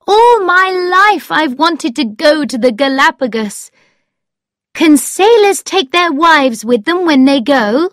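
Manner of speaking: high-pitched, eager rush of longing; thrilled, hopeful pleading; excited. A narrator's reading. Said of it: "she said in an excited voice".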